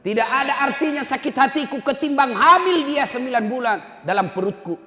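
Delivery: high, loud impassioned plea; mother's shout. Acted. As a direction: cry it out forcefully, easing at the end. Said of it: "ibunya berteriak". (jeritan ibu penuh emosi)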